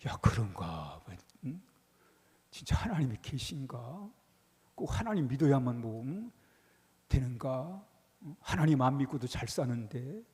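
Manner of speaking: low, mulling doubt voice; musing doubter. Retold or imitated. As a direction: wonder aloud in a low, unsure voice. Voicing doubting believer's thoughts. (흔들리는 신자의 낮은 혼잣말)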